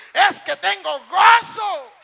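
slow, high, full-volume cry of joy; joyful shouting. Acted. Scolded worshipper answering that he has joy, acted joyful shout. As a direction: yell it back, drawn out and unstoppable. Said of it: "pero sigue gritando".

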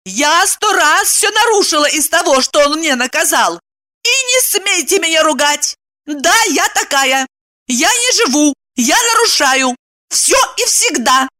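high-pitched defiant outburst; enraged. A narrator's reading. Wife defying her absent husband, enraged. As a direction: boast of it in a high fury. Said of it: "рассвирепела".

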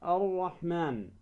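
الرحمن slowly, with a doubled R and no L; L melted into a held R in الرحمن; lam assimilated. Shown as a demonstration. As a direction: say الرحمن as a slow ar-rahman. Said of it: "اللام الشمسية ندغمها".

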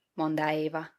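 ordinary delivery, nothing marked

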